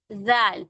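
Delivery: जाल with tongue tip between teeth; Arabic letter zaal. Shown as a demonstration. Teacher modelling जाल for kids. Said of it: "अब हम आते हैं जाल की तरफ".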